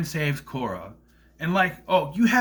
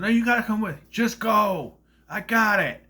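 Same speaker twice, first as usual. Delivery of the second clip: higher, louder urging; urgent shout; 6 semitones higher, 5 dB louder than usual. Retold or imitated. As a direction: push them to leave now. Hero urges others to flee.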